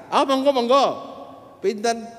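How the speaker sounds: high, loud welcoming driver; becak driver voice. Retold or imitated. Pedicab driver welcoming the preacher, high and loud.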